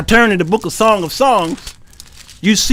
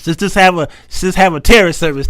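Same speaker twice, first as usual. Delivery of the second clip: ordinary delivery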